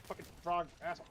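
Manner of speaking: usual speaking voice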